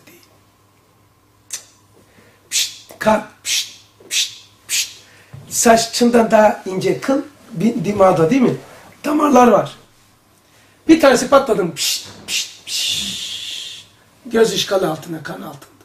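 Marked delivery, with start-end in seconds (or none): 2.5-4.9 soft unvoiced pişt pişt like a vessel popping; bursting vessel hiss. Teacher imitating a vessel bursting, soft hissed pişt. 11.7-13.8 soft unvoiced pişt pişt like a vessel popping; bursting vessel hiss. Teacher imitating a vessel bursting, soft hissed pişt.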